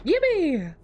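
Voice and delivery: high squeaky yippee; squeaky cheer. Real, not acted. Happy cheer in game.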